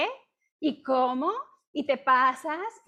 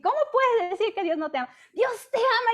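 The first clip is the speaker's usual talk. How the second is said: higher, louder, fast heated protest; heated rebuke; 8 semitones higher, 4 dB louder than usual. Retold or imitated. To a friend who says God doesn't love her, fired-up louder rebuke. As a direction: fire it back at her like a volley. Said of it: "yo me enchilo y saco mi metralla bíblica".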